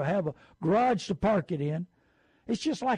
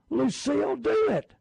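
shout; 8 semitones higher than usual. Real, not acted. Urging a listener to splurge.

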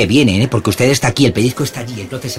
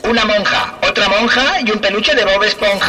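higher fairground prize call through a megaphone; fairground barker megaphone; 8 semitones higher than usual. Acted. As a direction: rattle off the prizes like a fair barker on a loudspeaker. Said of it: "frase de feriante".

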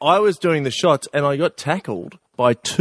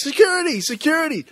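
yelling for help. Retold or imitated. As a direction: cry out for security. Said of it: "So I yelled to security".

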